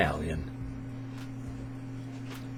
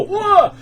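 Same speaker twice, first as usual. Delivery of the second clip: high, loud yelp of alarm; retold startled whoa; 6 dB louder than usual. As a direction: re-enact lunging in to stop them. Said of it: "so I had to go".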